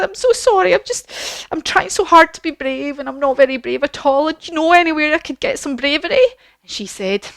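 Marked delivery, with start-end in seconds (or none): 0.0-6.3 high, whimpering cowardly lion; hammed-up tearful apology, pitched way up; mock crying. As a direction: wail it like a storybook crybaby. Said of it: "He starts to cry".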